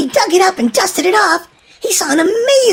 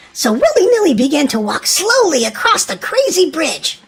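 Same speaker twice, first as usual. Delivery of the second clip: usual speaking voice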